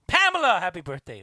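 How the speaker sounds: loud high call of a name; excited shout-out. Real, not acted. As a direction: call her name out big. Birthday shout-out.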